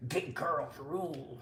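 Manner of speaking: put-on low voice; mock deep voice. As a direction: drop it way down, slow and playful. Repeats slogan in put-on low voice.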